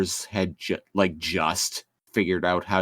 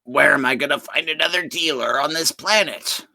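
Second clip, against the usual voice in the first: a higher, whining complaint; lightly voiced whiny villain; 6 semitones higher than usual. Co-host mocks the villain's plight with a higher whine. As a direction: whine like a stranded cartoon villain.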